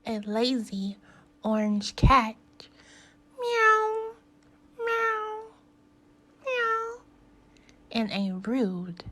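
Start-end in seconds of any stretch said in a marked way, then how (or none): 3.4-7.0 high squeaky meows; cat meows. Acted. Introducing the lazy cat with meows. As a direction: mew like a little cat. Cat noise in animal list.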